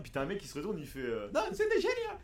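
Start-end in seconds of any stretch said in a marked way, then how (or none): 1.3-2.2 much higher, chirpy Mario imitation. Retelling the voice actor's cameo, in a high Mario voice. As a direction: squeak it out like the cartoon plumber. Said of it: "c'est le vrai doubleur de Mario".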